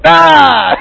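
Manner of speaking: high loud mock shriek of fright; startled scream. Startled disciples seeing Jesus appear, mock scream. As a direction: jump out of your skin.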